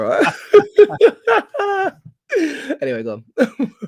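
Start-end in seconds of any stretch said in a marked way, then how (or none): 0.3-2.1 loud laugh. Real, not acted. Laughing after teasing his mate.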